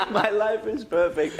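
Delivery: high, loud boast with laughter in it; triumphant shout to the street; gleeful yell. Retold or imitated. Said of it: "opening a window and yelling".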